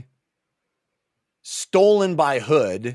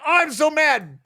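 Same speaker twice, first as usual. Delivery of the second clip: very high, hammed-up angry outburst; mock fury; 14 semitones higher, 4 dB louder than usual. Olar Ithil back in reality and raging, mock furious. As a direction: blurt out comic rage, pitched way up.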